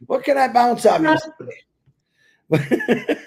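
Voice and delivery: drawn-out imitation of Chris's voice; imitating Chris. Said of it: "I was imitating you".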